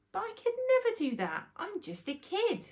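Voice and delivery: put-on a boy doubting himself; anxious protest. Rory telling Grandad he is just a kid, high worried child.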